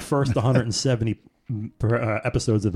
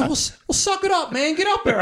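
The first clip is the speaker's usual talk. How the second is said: high laughing mock order; laughing taunt; 3 dB louder than usual. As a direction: laugh while bossing him.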